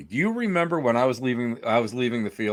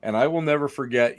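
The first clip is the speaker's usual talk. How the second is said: ordinary talk, nothing marked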